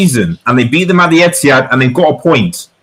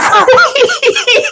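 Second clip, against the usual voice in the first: high, delighted burst of laughter; excited laugh. Real, not acted. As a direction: laugh out in disbelief. Laughing after the equaliser.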